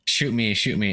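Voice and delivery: ordinary talk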